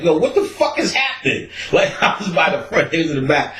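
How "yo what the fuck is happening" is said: high alarmed shout of surprise; startled. Retold or imitated. Hearing Doc retch in the back, high alarmed shout. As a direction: jump at the strange noise. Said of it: "I'm like".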